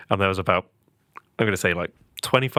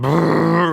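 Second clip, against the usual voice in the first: rolled brrr, higher and louder; imitated running herd; 6 semitones higher, 5 dB louder than usual. Telling how cows lapped the field, imitated rumble. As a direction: trill out the rumble of stampeding cows. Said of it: "two laps of the field going".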